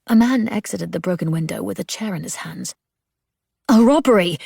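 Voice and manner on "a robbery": higher and much louder, startled; alarmed cry. A narrator's reading. Grace seeing a looter, loud startled cry. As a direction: cry it out as the chair comes through.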